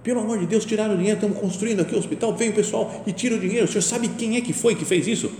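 high, pleading protest about stolen money; indignant complaint. Retold or imitated. A man protesting to his superior, high and indignant. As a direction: plead in outrage, voice pitched high.

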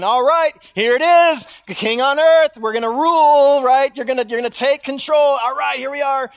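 excited disciples expecting a king; eager followers. Acted. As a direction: play the pumped-up followers sure of victory. Followers acted, eager for kingdom.

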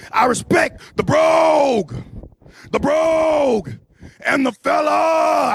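high, drawn-out last words; theatrical, slow promo flourish; hammed-up declaiming. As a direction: stretch "brogue" and "fella" out long and high. Hammed-up list of respected things.

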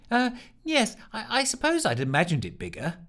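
conceding. Acted. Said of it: "conceded Ellen".

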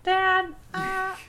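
put-on high childish voice; seven-year-old kid. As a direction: play a flustered little boy. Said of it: "I'm, like, seven".

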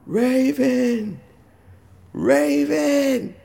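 high calling of a name across chaos. Retold or imitated. Wife calling to her husband across the wreckage, high and urgent. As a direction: call his name over the din.

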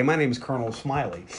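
low, soft smug intro; smug quiet. Retold or imitated. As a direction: say it soft and self-satisfied. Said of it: "And he's like".

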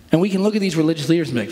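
normal talking voice

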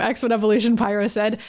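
ordinary delivery, nothing marked

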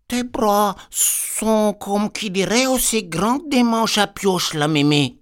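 put-on high, slow, wide-eyed little girl. Little girl asks her granny about her arms, high and puzzled. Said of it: "qu'elle lui a dit".

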